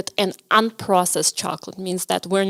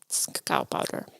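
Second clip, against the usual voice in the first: whispering; 14 dB quieter than usual. Real, not acted. Explaining the topping.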